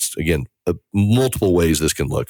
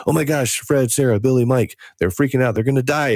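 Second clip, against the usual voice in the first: panicked family caller; 7 semitones higher than usual. Retold or imitated. Panicked family calling in crisis.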